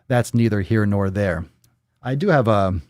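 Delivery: usual speaking voice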